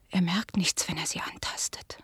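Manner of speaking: soft whisper, trailing off; whispered reassurance. Acted. Daughter reassuring the guest about her blind father, whispered. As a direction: barely breathe the words.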